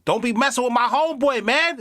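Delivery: high-pitched protesting homeboy voice; comic voiceover character. Acted. Dude defending his homeboy in a dub, high comic protest. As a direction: squeal it like a cartoonish street dude. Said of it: "one of those voices with the southern twang".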